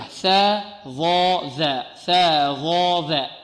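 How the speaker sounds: "ثا ظا ذا" said very slowly, tongue tip between the teeth; articulation drill. Shown as a demonstration. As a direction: drill "ثا ظا ذا" letter by letter, drawn out. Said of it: "تخرج من طرف اللسان".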